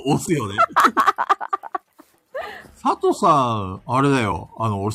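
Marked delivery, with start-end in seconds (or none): 0.6-1.8 high-pitched laughing fit; teasing laugh. Real, not acted. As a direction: laugh in a high burst at your friend. 佐藤さん推しをからかい笑う. (高い声でからかい笑い)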